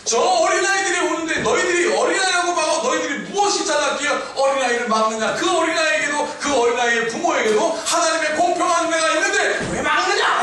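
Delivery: loud, high-pitched impassioned rebuke; shouted sermon quote peaking on the last question; 예수님 shouting. Acted. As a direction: hurl indignant questions at full voice. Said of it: "지금 소리치고 계시는 것입니다".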